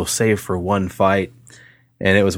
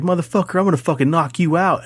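raised-pitch imitation of a bully's threat; mock threat; 8 semitones higher than usual. The bully threatening to knock him out, high mock threat. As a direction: ham up the tough guy, pitched high.

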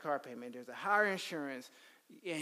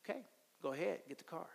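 resigned father; 9 dB quieter, much faster than usual. Retold or imitated. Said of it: "So my father said".

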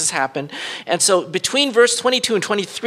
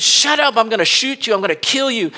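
higher, louder mock threat from a carjacker; retold carjacker yell; 6 semitones higher, 4 dB louder than usual.

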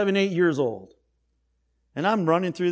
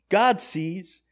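usual speaking voice

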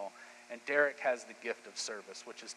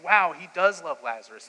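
louder, lifted "wow" of amazement; awed wonder; 6 semitones higher, 12 dB louder than usual. Acted. Mourners watching Jesus weep, loud awed wonder. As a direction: marvel out loud at his love.

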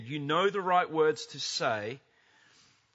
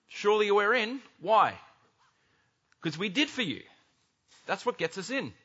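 confident pleading; 5 semitones higher than usual. Acted. People at pearly gates.

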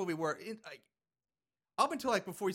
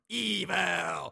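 long drawn-out, louder "Evil"; hammed-up villain name; 5 dB louder than usual. Host naming the killer, hammed up. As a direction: stretch it out like a horror announcer.

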